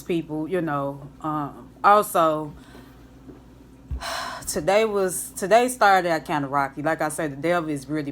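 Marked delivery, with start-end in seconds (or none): 3.9-4.4 long weary exhale; tired sigh. Real, not acted. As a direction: let out a heavy sigh. Tired sigh before rough day.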